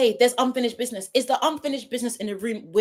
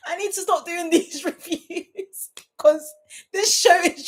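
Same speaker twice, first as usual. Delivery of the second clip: high, helpless laughter breaking through the words; crying with laughter; 5 semitones higher than usual. Real, not acted. Reviewer begging herself to stop, crying with laughter. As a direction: try to talk through a real laughing fit. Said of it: "Guys, I'm crying".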